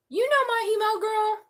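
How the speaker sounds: sassy put-on voice. A tarot reader tells a viewer her email, sassy high put-on voice. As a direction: toss it off like a sassy best friend.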